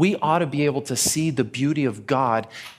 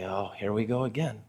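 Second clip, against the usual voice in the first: resigned grumble; 5 semitones lower, 7 dB quieter than usual. Retold or imitated. Offered wine again.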